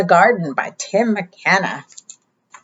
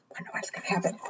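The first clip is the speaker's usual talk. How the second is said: whisper; 18 dB quieter, much faster than usual. Shown as a demonstration. Suspense aside to children.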